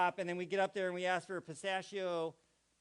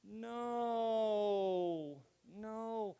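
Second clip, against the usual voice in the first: a mild wail of no, no; like a toddler whose world just ended; mock despairing no; dismayed no; 4 semitones higher than usual. Shown as a demonstration. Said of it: "when something really, really bad happens".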